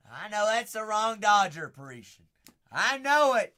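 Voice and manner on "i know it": high and loud, thrown out hard; loud gloating. Real, not acted. Breaker gloats over the wrong Dodger, loud and high. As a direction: crow it at full volume. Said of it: "Too loud, too loud".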